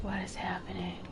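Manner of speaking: hushed, wary murmur; uneasy hush. Real, not acted. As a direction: ask it under your breath, afraid to be heard. Uneasy in a creepy area.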